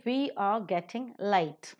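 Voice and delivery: "getting" and "late" stressed, "We are" weak; slow line leaning on "getting" and "late". Shown as a demonstration. Teacher models the sentence, stressing "getting" and "late". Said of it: "getting a being a verb and late being an adverb".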